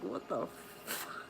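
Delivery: breathless whispered what the fuck; slow hushed disbelief; shocked whisper. Retold or imitated. Retold saline rinse reaction.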